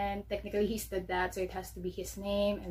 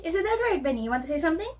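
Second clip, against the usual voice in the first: high-pitched questions to a dog; playful pet talk; 7 semitones higher, 4 dB louder, much faster than usual. Real, not acted. Asks her dog playfully.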